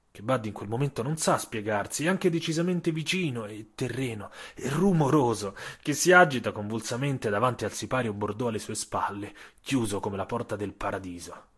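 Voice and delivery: ordinary delivery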